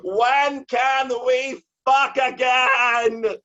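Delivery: slow, high, loud shout; shouted question. Retold or imitated. Poet interrupting a city official, shouted question. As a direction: blurt it across the meeting hall. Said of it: "interrupt and ask my question".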